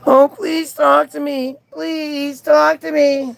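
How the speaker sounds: high, drawn-out fake pleading; mock begging. Streamer mimics the guy begging to talk, mock begging. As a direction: imitate a desperate beggar, hammed up. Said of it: "notice how the guy keeps begging".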